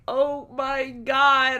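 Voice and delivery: high, very drawn-out amazement; slow, high starstruck exclamation; retold freak-out. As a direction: stretch every word in retold disbelief. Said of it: "I was freaking the fuck out".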